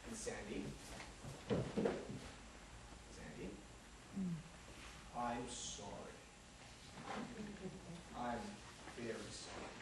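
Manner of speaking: low, hushed, half-whispered apology; rehearsed sincere apology. Acted. [name] gives Sandy his rehearsed, hushed heartfelt apology. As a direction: lay it on softly, as if from the heart. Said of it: "I rehearsed for over an hour".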